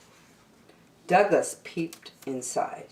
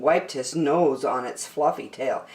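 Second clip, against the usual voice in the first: ordinary delivery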